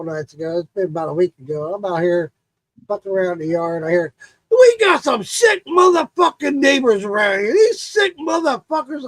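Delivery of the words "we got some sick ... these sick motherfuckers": neighbor yelling. Retold or imitated. Neighbor yelling over the fence.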